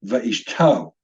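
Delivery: va'ishto said slowly as va-eesh-TOH, falling; stress on last syllable. Shown as a demonstration. Teacher gives the correct stress, slowly stressing TO in va'ishto. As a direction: land firmly on the final TO of va'ishto. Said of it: "it's va'ishto".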